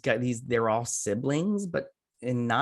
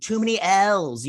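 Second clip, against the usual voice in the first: raised, louder exasperated aside; 7 semitones higher, 5 dB louder, slower than usual. Real, not acted. Host tripping over Lois and Lana, exasperated. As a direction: drag it out, higher and louder. Tangled up by similar names.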